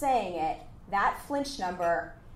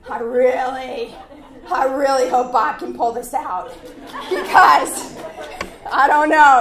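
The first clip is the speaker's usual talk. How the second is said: much louder, laughing through the words; 3 semitones higher, 10 dB louder, slower than usual. Real, not acted. Speaker laughing at her own nerves in the negotiation, loud. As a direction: break into laughter while admitting fear.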